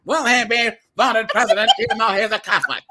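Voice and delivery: hammed-up old mid-Atlantic news read; mid-Atlantic accent. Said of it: "this mid-Atlant-, Atlantic accent".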